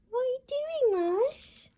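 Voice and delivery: high-pitched, drawn-out coo to a little dog; pet-talk coo. Real, not acted. Owner to her dog Marsh, high cooing pet talk.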